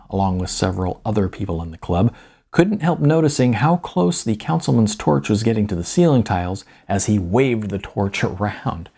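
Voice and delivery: ordinary delivery, nothing marked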